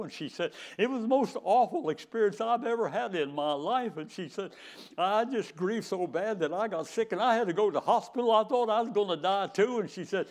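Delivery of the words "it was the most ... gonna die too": higher and louder, imitating a distressed widow; a lightly voiced widow, higher and louder; retold grieving widow. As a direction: pour out her grief as the widow, voice raised. Grieving widow pours out story.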